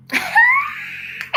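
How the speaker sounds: very high burst of laughter; delighted laugh. Real, not acted. Real laugh as the shower head finally opens. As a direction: laugh in disbelief that it worked.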